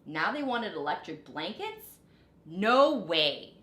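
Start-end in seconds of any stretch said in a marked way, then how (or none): 2.4-3.6 loud flat no; loud stubborn farmer refusing; firm refusal. A narrator's reading. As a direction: shut it down hard. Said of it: "said Farmer Brown".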